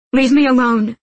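loud, high angry order; angry outburst. A narrator's reading. Nina to Matthias as he follows, angry outburst. Quarrel, she pulls away from him.

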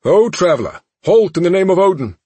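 booming formal sentry voice; guard voice. Acted. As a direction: call out like a stern gatekeeper. Asgardian guard hails them.

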